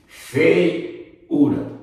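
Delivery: Feiura split as FEI... u-ra; syllables shown. Shown as a demonstration. Teacher shows the diphthong before U, Feiura broken after fei. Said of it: "nós temos um ditongo".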